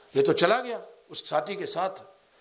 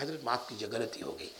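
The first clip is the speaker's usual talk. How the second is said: sheepish apology; 7 semitones lower, 14 dB quieter, faster than usual. Retold or imitated. Repentant man apologizing to elder.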